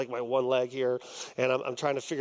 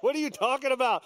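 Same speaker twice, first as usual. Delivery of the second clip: incredulous; 11 semitones higher than usual. Retold or imitated. Retold disbelief at friend's challenge.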